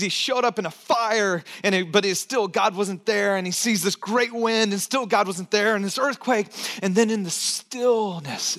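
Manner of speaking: ordinary talk, nothing marked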